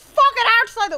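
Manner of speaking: sudden high, loud outburst; high-pitched burst of frustration; frustrated shout. Real, not acted. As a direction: yell it in exasperation at the game.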